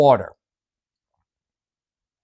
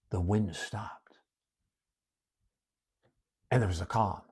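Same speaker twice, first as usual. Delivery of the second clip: hushed narration; 4 semitones lower, 12 dB quieter than usual. A narrator's reading. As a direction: let the calm settle in the voice. Narrating sudden calm.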